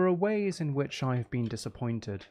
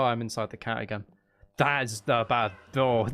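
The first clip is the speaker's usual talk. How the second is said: normal talking voice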